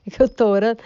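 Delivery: loud amused echo of his question; mock amused echo. Wife echoing her husband's question, loud and amused. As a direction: throw his question back, delighted. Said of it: "Eu achei aquilo um espetáculo".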